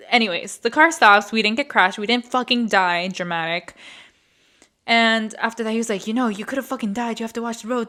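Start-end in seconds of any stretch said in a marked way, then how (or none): none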